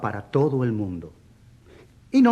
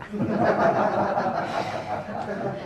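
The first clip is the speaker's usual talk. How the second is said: risas sin voz, sostenidas; ríe largamente tras la pulla; 4 semitones higher, 5 dB quieter than usual. Acted. Risa tras la burla sobre lectura. (carcajadas burlonas)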